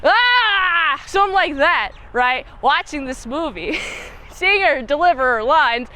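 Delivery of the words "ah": frustrated cry. Real, not acted. Frustrated outburst.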